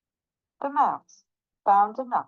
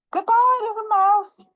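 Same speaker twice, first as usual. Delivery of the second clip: high, loud hasty goodbye; hammed-up fleeing panic; panicked farewell; 12 semitones higher, 7 dB louder than usual. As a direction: call out as you escape. Owl hurriedly flees.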